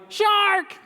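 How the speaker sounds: high excited call of Shark; shouted title. Shown as a demonstration. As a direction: cry out Shark like an alarm. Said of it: "Can you say that really loud?".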